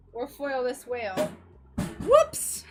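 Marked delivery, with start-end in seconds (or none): 2.0-2.6 sudden high, loud yelp; screamed whoops. Real, not acted. Streamer slips up while working, startled high scream. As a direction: yelp it out, pitch leaping up. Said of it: "Sorry, I'm screaming".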